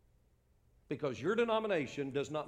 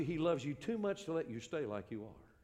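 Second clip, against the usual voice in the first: usual speaking voice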